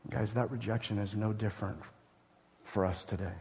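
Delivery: low, soft and grave; sober hush. Real, not acted. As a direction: let the weight sink in, low and soft. Preacher drops to sober hush.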